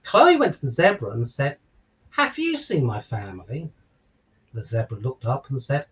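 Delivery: put-on bright, lilting picture-book narration for children; story reading. A narrator's reading. Father reading a giraffe story to listeners, lilting storybook narration. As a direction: read it like bedtime story time for little ones.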